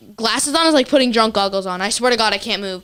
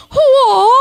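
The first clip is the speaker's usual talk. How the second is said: high loud mock karate yell; karate cry; 13 semitones higher, 7 dB louder than usual. Daughter imitating mom's karate yell, high loud. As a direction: shout Whoa like a kung fu kick. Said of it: "She starts going".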